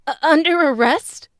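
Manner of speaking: loud, shocked exclamation. Acted. Woman hearing her friend is arrested, shocked and loud. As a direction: blurt it out in disbelief.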